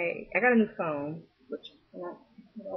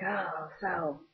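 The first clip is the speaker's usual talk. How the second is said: put-on old pimp; slower than usual. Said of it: "he was like".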